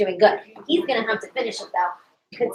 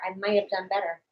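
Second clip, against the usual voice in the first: ordinary talk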